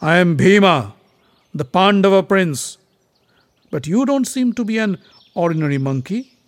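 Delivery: loud, high-pitched proclamation; forceful, challenging hero voice; proud prince. A narrator's reading. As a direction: declare yourself boldly to a rival. Proud prince answers the monkey.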